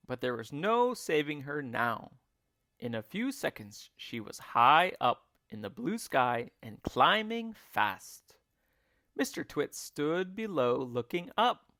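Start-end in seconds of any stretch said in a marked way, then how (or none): none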